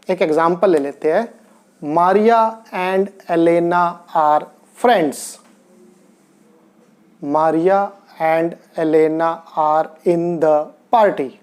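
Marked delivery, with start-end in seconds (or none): 1.8-5.2 very slow, word by word; slow dictation; slow example. Shown as a demonstration. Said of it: "teacher slow example sentence". 7.2-11.3 very slow, word by word; slow dictation; slow example. Shown as a demonstration. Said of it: "teacher slow example sentence".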